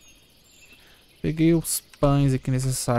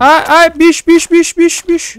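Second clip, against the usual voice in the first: high frightened shrieks; panicked yelling; 12 semitones higher, 9 dB louder than usual. Real, not acted. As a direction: yell in real panic. Monster jumps out, panic.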